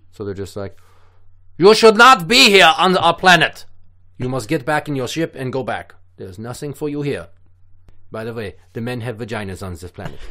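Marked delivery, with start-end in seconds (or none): 1.6-3.7 loud, high German military shout; mock German accent. Militant alien confronts human invaders, shouted mock German accent. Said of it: "but they're German". 4.2-10.2 put-on stern German-accented alien officer; easing from command into deadpan German accent; mock German accent. Alien sends visitors back to their ship, mock German accent. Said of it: "but they're German".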